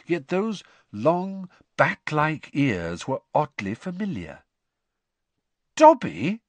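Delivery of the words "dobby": startled high rising question; can't believe who it is; incredulous. Acted. Harry spotting a familiar-looking elf, incredulous. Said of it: "said Harry incredulously".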